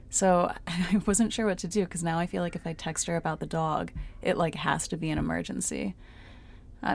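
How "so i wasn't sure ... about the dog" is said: ordinary talk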